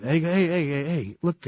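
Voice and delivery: ordinary talk, nothing marked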